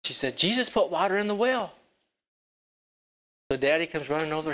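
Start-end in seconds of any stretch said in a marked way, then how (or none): 0.4-1.7 high, thrilled announcement; excited girl. Acted. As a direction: tell it with pure delight. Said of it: "So she got really excited".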